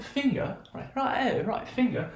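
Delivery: high-pitched put-on British voice; British accent. Shown as a demonstration. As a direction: demonstrate a mock British accent, high and playful. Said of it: "notice we're doing the British one".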